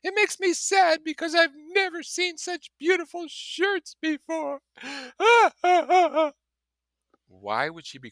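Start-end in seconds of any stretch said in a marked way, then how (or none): 0.0-4.6 very high, loud, sobbing wail; crying stormily. Acted. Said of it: "began to cry stormily". 4.7-6.3 sob. Acted. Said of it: "began to cry stormily".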